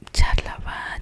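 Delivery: plain reading voice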